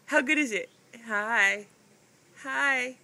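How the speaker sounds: ordinary talk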